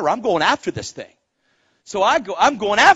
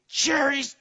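high, silly announcing voice; mock talking cherry tree; 8 semitones higher, 4 dB quieter than usual. Cherry tree proclaiming itself in the orchard, high mock call. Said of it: "doesn't stand in the orchard and say".

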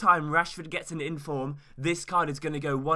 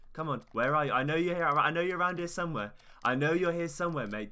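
ordinary talk, nothing marked

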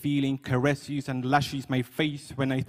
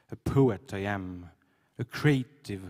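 normal talking voice

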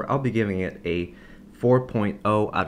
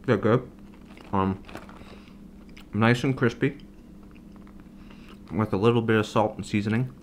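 talking between bites; chewing fries while speaking; mouth full, chewing. Real, not acted. Seen 0: Eats fries between phrases; bites at 7.1, chews while talking.

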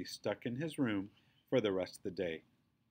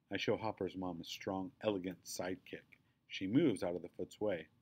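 plain reading voice

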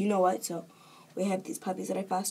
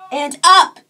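loud, high, triumphant call; called-out cue; 7 semitones higher, 16 dB louder than usual. Real, not acted. Vlogger finishing the flippy thing, loud high cue. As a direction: shout the upward cue, pitch leaping. Calling cue for flip trick.

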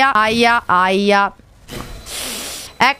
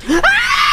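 very high, piercing shriek; terrified scream. Real, not acted. Streamer sure about the demon then scared, terrified scream. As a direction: scream as if scared out of your wits. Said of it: "Sono cagata addosso".

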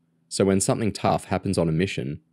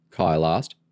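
ordinary delivery, nothing marked

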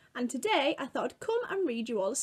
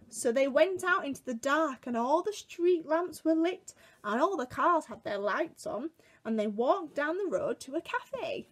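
ordinary delivery, nothing marked

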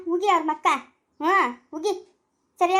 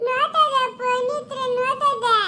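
put-on slow, much higher child's whine; whining hurt child; 7 semitones higher, much slower than usual. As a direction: complain of the pain like a small child, slowly. Hurt child complaining of pain.

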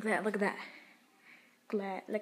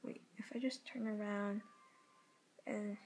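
whispering; 8 dB quieter than usual. Real, not acted. Whispered aside.

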